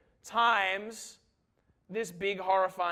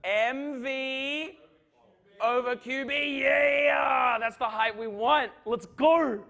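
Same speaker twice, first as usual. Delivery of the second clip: excited cheer; 6 semitones higher, 5 dB louder, much slower than usual. Real, not acted. Triumphant answer to class question.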